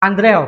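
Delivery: "Andrew" read as written, AN-dre-o, loud; Indonesian spelling pronunciation. Shown as a demonstration. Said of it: "tulisannya Andrew dalam bahasa Indonesia".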